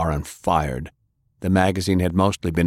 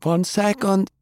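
higher, busy healer; Jane's voice; 13 semitones higher than usual. A narrator's reading. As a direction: toss it off, hands full. Jane voiced high while working.

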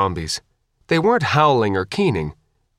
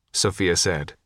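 ordinary delivery, nothing marked

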